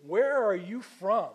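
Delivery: loud, slow, exasperated parent asking; exasperated demand. Acted. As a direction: demand it like a fed-up parent to a teenager. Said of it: "Have you ever said that to your teenager?".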